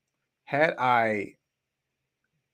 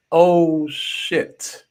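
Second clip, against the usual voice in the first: startled; 6 semitones higher, 9 dB louder than usual. Retold or imitated. Jolted awake by the scare.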